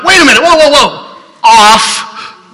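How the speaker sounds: loud, high startled whoa whoa; preacher retelling switching off the radio, loud; alarmed protest. Retold or imitated. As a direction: shout it while grabbing for the dial. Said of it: "I'm like".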